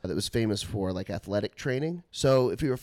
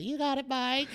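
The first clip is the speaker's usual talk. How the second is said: high old woman's voice, imitating the dean's scheduler; a sweet little old lady calling a student; 13 semitones higher, 4 dB quieter than usual. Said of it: "thousand-year-old woman named Dottie".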